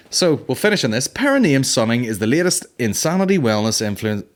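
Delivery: normal talking voice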